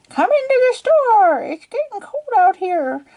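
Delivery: high, hammed-up mom voice calling her daughter in; squeaky mock mom telling me to come inside; silly mom voice. Mom calling her daughter into the store, in a high silly voice. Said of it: "that's not what my mom sounds like".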